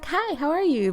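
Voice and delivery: high customer-service greeting; put-on high phone hello; professional voice. Shown as a demonstration. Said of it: "my professional voice".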